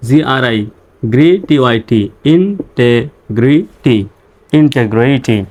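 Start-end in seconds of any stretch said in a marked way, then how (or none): 2.3-4.0 slow, split-up "ইনটেগ্রিটি" with gaps between syllables; teacher's slow sounding-out. Shown as a demonstration. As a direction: break "ইনটেগ্রিটি" into separate syllables for learners. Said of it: "আমার সঙ্গে সঙ্গে উচ্চারণ করবেন".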